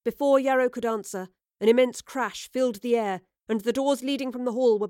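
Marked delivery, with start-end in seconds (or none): none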